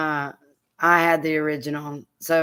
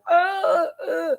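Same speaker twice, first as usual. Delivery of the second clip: high, wailing mock sob of the arrested man; a sudden high crying howl, half mocking; imitated crying wail; 13 semitones higher, 3 dB louder than usual. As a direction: wail it like a howling prisoner. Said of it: "the guy's like".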